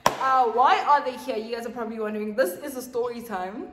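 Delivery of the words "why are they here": normal talking voice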